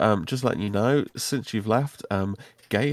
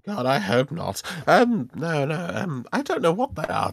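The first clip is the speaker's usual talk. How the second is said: high-pitched wizard with swooping tune; flustered wizard; 7 semitones higher than usual. Acted. Wizard answering a customer about red crystals, flustered. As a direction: play a wizard protesting in a high voice.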